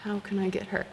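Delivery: hushed, mischievous scheming; sneaky plotting. Retold or imitated. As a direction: murmur your prank plan. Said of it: "I'm like".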